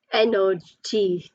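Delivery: "Energy" slowly in three beats; syllable by syllable. Shown as a demonstration. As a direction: stretch "Energy" so each syllable shows. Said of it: "three syllables".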